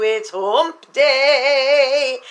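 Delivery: teasing sing-song hump day call; drawn-out catchphrase. Acted. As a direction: savor each word like a fun announcement. Playful weekly catchphrase.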